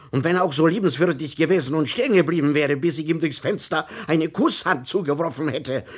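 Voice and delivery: high, loud exasperated rant; exasperated outburst. Acted. Angry official berates the patient inspector, loud and high. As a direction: vent it all, loud and high-pitched.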